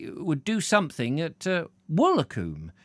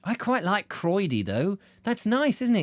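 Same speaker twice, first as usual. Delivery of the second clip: usual speaking voice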